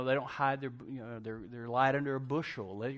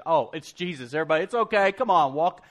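raised, loud mock cheer; mock rallying call; 3 semitones higher, 9 dB louder than usual. Imagined disciple telling everyone it's okay, mock rallying. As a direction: call everyone over as if all is fine.

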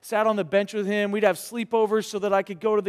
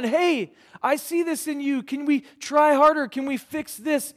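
much higher, bright coaching encouragement; pep up a young player; upbeat coach; 8 semitones higher, 4 dB louder than usual. Retold or imitated. Coach spurring on his young players, higher and upbeat.

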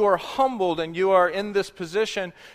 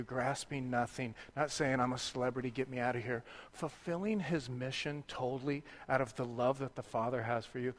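much softer, reflective sermon voice; quiet meditative preaching; 6 semitones lower, 15 dB quieter than usual. Acted. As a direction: draw the congregation inward, gently.

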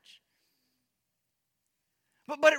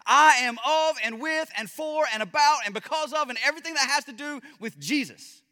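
impassioned preaching; 4 semitones higher, 4 dB louder than usual. Acted. Preaching builds to full force.

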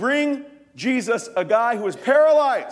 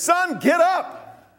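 higher, very slow, solemn command; commanding Jesus; 9 semitones higher, 3 dB louder, much slower than usual. Acted. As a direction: speak it like a miracle command. Jesus raising widow's son.

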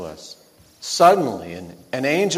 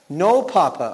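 put-on higher, louder protest of an upset boy; pleading cry; 4 semitones higher, 7 dB louder than usual. Boy begs his papa to spare his lamb, pleading cry. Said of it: "I cried".

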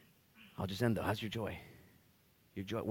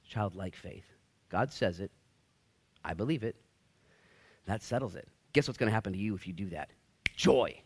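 usual speaking voice

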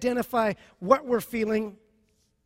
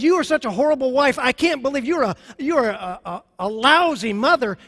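loud, hurt insult hurled at a spouse; angry accusation; 3 semitones higher, 6 dB louder than usual. Acted. Husband accusing his wife, loud and bitter. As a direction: throw the insult at your wife. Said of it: "and said".

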